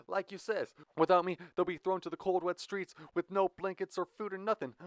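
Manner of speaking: put-on high, fast begging in an Irish accent; Irish accent pleading. Jack begging Blue in a fake Irish accent. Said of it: "The Irish accent dropped from Jack's mouth".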